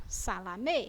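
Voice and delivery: SALAMÊ low and soft with closed Ê; quiet closed ending on salamê; closed vowel. Shown as a demonstration. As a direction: keep the final Ê of salamê closed. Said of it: "Ela tem fechado".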